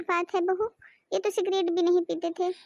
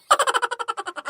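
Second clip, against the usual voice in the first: laugh; 6 semitones higher than usual. Acted. Mother-in-law laughs mid-story.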